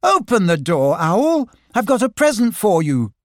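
high, loud call through a door; shouting. Acted. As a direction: shout to get him out. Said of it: "Christopher Robin shouted".

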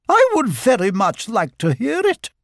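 high, eager little request; high-pitched courteous story character; polite character voice. A narrator's reading. Lottie's line in the story.

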